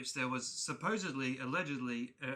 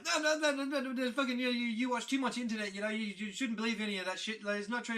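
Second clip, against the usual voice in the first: high, loud stream of mock scolding; ranting dad; 9 semitones higher, 4 dB louder, faster than usual. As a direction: imitate dad's dismissive rant, starting loud and easing off. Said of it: "he's just going like".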